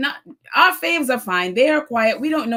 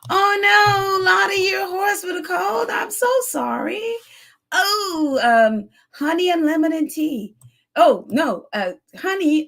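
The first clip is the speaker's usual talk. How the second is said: exaggerated sympathy; 10 semitones higher than usual. Real, not acted. Viewer's horse has a cold.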